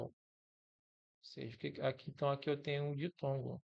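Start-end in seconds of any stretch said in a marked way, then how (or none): none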